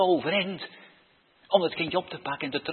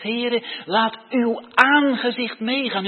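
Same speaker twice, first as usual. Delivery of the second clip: fervent loud prayer; 7 semitones higher, 8 dB louder, slower than usual. Acted. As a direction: lift the prayer high and loud. Preacher calls out opening prayer line. (luid biddend uitroepen)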